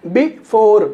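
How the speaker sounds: "before" split, short bi, long four; stress on second syllable. Shown as a demonstration. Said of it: "और ये खींच गया".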